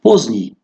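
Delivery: «Поздний» said poznij, no д; silent д. Shown as a demonstration. Teacher telling learners the д in «Поздний» is silent. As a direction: say «Поздний» without the д. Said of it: "ҳарфи «д» инҷо нест".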